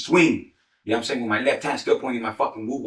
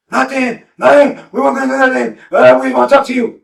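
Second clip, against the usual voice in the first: high, loud, scared denial; terrified men pleading at gunpoint; panicked pleading; 13 semitones higher, 10 dB louder than usual. Retold or imitated. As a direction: beg him to stop, voice way up. Frightened men answer.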